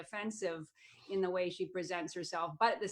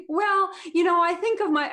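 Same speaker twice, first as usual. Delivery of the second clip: higher, louder mock-confident pundit; Tomi Lahren impression; 9 semitones higher, 10 dB louder than usual. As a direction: launch into her like a cocky talking head. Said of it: "Come on, let's see it".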